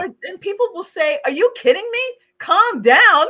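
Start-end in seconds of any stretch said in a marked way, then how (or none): 1.3-3.3 higher, louder, laughing disbelief; hammed-up indignant customer; mock outrage. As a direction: play the offended customer, half laughing. Customer reacting, quoted.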